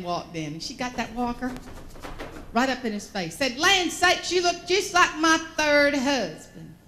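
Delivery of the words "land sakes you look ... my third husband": put-on loud, higher-pitched gushing old Southern lady; imitating an enthusiastic aunt, drawn out. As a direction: gush like a delighted elderly aunt. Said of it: "Aunt Josie is very enthusiastic".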